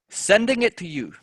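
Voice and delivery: "Sending" hit high and loud on its first syllable; stress on SENDING. Shown as a demonstration. Teacher demonstrating first-syllable stress on "Sending". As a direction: punch the start of "Sending". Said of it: "first syllable of the word sending".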